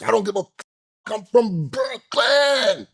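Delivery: high, slow, drawn-out mock yell; mocking his Brooklyn brag at the bar, a drawn-out high yell; mocking shout. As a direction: ham up his tough-guy boast as a wail. Mocking the man's Brooklyn boast.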